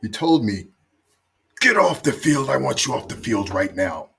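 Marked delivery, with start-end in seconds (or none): 1.6-4.1 raised, louder barked order from the captain; retold angry order. Captain ordering a player off the pitch, angry shout. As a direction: bark him off the pitch, half-acted. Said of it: "Chris lost it".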